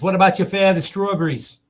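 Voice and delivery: a lightly voiced old-timer; mock old man in the ad. Host quoting the ad, hammed-up old-timer voice. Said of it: "Pepperidge Farm remembers".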